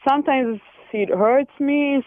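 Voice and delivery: ordinary talk, nothing marked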